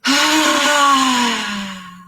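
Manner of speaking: loud open-mouthed breath out; big lion's breath exhale. Shown as a demonstration. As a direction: roar the breath out like a lion. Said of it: "Ready for the lion's breath?".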